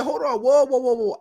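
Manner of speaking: high-pitched comic panic; hammed-up alarmed player; acted alarm. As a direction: plead to halt things in a high voice. Acts injured player calling a halt.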